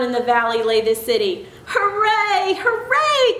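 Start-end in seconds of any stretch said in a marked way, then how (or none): 1.7-3.4 high cheer, the second higher still; jubilant cry. A narrator's reading. Clown and toys at the mountain top, jubilantly cried. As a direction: cheer out high, stretching each hooray. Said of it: "cried the funny little clown".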